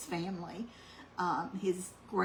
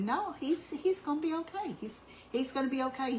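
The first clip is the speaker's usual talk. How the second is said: retold reassurance in a raised pitch; insistent reassurance; 6 semitones higher than usual. Retelling Mike assuring Linda about his brother, insistent reassurance.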